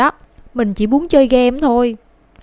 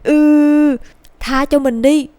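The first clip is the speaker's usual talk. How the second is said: louder, higher groan of give me a break; boy fed up with the rooster's prophecy; exasperated plea; 4 semitones higher, 4 dB louder, much slower than usual. Acted. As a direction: beg to be spared, fed up. Boy clutches his head.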